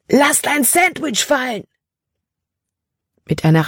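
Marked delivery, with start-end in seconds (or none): 0.1-1.6 much higher, far-off command; urgent shouted warning. Acted. Caleb shouting a warning to Libby from afar. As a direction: yell the order across the distance.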